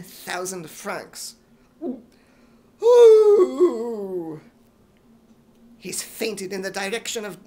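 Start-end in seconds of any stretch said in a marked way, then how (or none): 2.8-4.4 very loud swooning wail; fainting swoon cry. Acted. Major faints.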